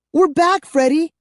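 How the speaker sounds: high, bright joyful shout; girl crying out happily to her friend; happy cry. A narrator's reading. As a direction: burst out with relief and joy. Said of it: "she cried happily".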